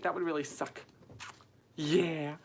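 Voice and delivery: ordinary talk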